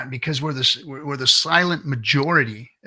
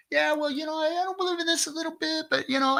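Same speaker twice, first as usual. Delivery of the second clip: mock wishy-washy voice.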